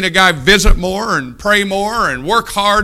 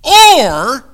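shout; 9 semitones higher, 9 dB louder than usual. Real, not acted.

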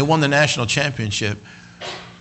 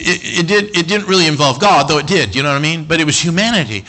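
normal talking voice

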